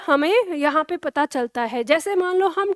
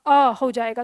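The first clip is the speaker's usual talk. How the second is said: usual speaking voice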